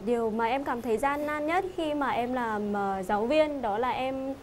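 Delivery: normal talking voice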